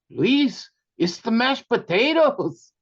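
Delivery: mock matter-of-fact hostess; imitating sister-in-law. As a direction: spell it out to a clueless guest. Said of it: "And she said".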